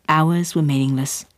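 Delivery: usual speaking voice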